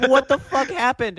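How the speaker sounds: loud, high-pitched disbelief; retold outburst of shock, voice jumping up; shocked reaction. Student finding a hole in the classroom wall, loud shocked outburst. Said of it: "And I'm like".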